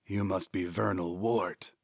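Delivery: soft, throaty jackal figure; a quiet throaty voice out of shadow. A narrator's reading. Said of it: "came a throaty voice".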